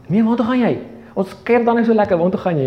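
high, pleading, voicing the disciples; protesting disciples. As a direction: plead with him not to leave. Disciples protest Jesus leaving.

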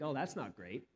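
quiet deadpan understatement; mild dismay. Retold or imitated. Parent seeing toddler paint the whole house, mild dismay. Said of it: "you're like".

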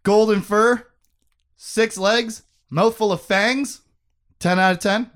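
loud, high, gleeful announcing; excited dog-lover rave; delighted. Real, not acted. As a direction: announce each detail with proud delight.

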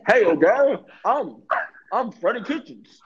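high, loud, goofy caricature; hammed-up addled coach; Freddie Kitchens. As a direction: introduce yourself in a dopey, swooping voice. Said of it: "Freddie Kitchens walks in and says".

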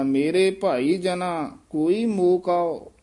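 ਮੇਰੇ ਭਾਈ ਜਨਾ slow and full, break, then ਕੋਈ ਮੋਹ ਕਉ; pause after ਜਨਾ. Shown as a demonstration. Teacher shows where the rest falls, slow with a pause. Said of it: "ਇੱਥੇ ਵਿਸਰਾਮ ਲੱਗੇਗਾ".

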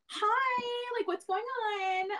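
high, slow and airy hello; bubbly phone voice. Retold or imitated. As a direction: sound overly upbeat and sweet. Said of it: "talking like".

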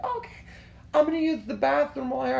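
put-on high-pitched, quick girlfriend voice; imitated girlfriend. Girlfriend about to use the bathroom, imitated. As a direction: mimic her agreeing in a high voice. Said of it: "She's like".

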